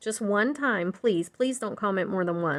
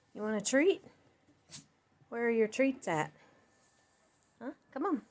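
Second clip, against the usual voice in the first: talking to a dog; 3 semitones higher, 3 dB quieter than usual. Real, not acted. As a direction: coo at the dog. Talking to her dog Sadie.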